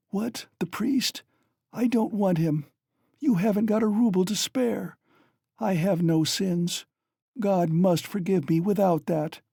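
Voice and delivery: high, weary, bitter dying mother; Katerina, dying. A narrator's reading.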